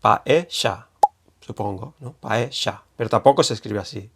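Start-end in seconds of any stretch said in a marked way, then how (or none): 0.0-0.9 Argentine paella with a sh sound, /paeʃa/. Shown as a demonstration. Teacher demoing the Argentine sh in paella. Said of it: "con acento argentino". 2.1-2.8 Argentine paella with a sh sound, /paeʃa/. Shown as a demonstration. Teacher demoing the Argentine sh in paella. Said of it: "con acento argentino".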